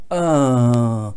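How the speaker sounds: loud, grumbling "uh"; short grumpy groan; demonstrated groan. Shown as a demonstration. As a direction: groan like someone in a bad mood. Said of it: "when I say that someone groans, you go".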